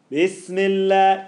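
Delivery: slow, loud "Bismillah" with the mouth held open. Shown as a demonstration. Teacher shows the default open mouth shape. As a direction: keep the mouth open and drawn out. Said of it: "it's open kind of mouth".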